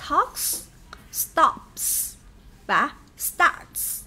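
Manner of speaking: Talks, stops, starts each ending on a crisp s; các từ kết thúc bằng âm s; final s examples. Shown as a demonstration. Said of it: "được phát âm là S".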